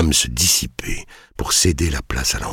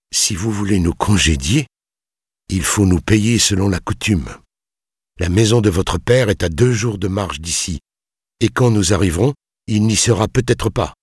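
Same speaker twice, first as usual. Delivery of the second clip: higher, insistent argument; forced courage; 7 semitones higher, 3 dB louder than usual. A narrator's reading. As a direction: push yourself to press the demand. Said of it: "Il se força néanmoins à dire". (insistance tendue, en se forçant)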